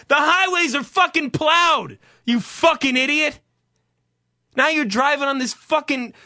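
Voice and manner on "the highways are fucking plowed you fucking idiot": louder, higher angry outburst; exasperated rant. Real, not acted. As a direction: chew out a clueless driver. Rants at imagined panicky drivers.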